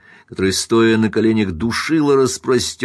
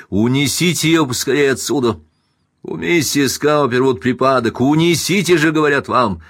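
raised, insistent commands; urgent orders; 6 semitones higher, 4 dB louder than usual. A narrator's reading. Husband orders servants to carry the victim out, urgent. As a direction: order them to act immediately.

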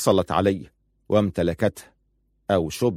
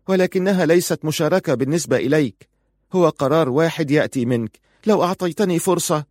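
higher-pitched wife arguing back; wife, protesting; 7 semitones higher than usual. A narrator's reading. Wife objects that husband decides alone, protesting. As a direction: argue for a chance, pitched up.

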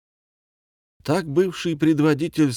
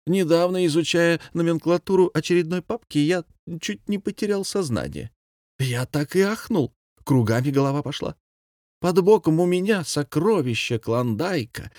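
higher, animated revelation; lightly voiced excited commandant; 7 semitones higher than usual. A narrator's reading. Commandant revealing treasure to Pavel, lightly amazed. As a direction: tell it with mock astonishment.

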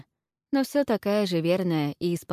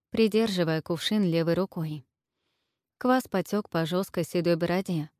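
ordinary delivery, nothing marked

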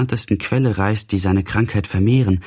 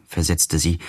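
ordinary delivery, nothing marked

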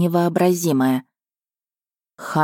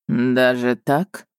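slow, lightly lowered musing prince; drawn out thoughtfully; 4 semitones lower, much slower than usual. A narrator's reading. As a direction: stretch it out, thinking. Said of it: "задумчиво протянул наследник". (задумчиво, протяжно)

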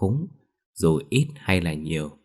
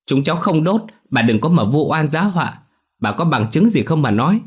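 high, loud protest; boy defying the old woman; defiant denial; 9 semitones higher, 5 dB louder than usual. A narrator's reading. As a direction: talk back hard, demanding proof. Boy denying accusation.

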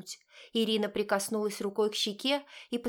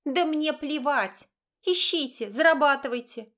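angry shout; 6 semitones higher, 5 dB louder than usual. A narrator's reading. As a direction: shout her down. Mother yelling at daughter.

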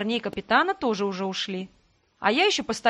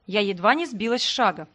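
ordinary delivery, nothing marked

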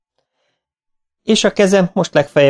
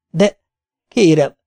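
a mild stammer, halting; 6 semitones higher, 3 dB louder, slower than usual. A narrator's reading. Student pleading with the examiner, stammering. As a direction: stumble over the words.

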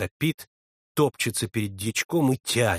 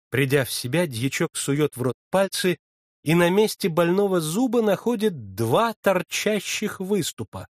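ordinary delivery, nothing marked

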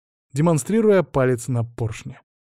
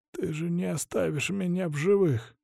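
soft, high-pitched, laboured plea; weak strained voice; 6 semitones higher, 6 dB quieter than usual. A narrator's reading. Poisoned hostage to his captor, weak and strained. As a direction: force it out feebly. Choking hostage expects death.

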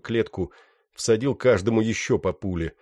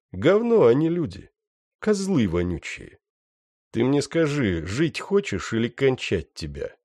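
contemptuous anger; 6 semitones higher than usual. Acted. Герой угрожает пленному.

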